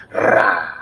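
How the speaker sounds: loud rolled "rrrah"; trilled R bursting into an open "ah". Retold or imitated. Teacher imitating a rapper to show rolled R's, loud "rrrah". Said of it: "Designer. Yeah, that was his name".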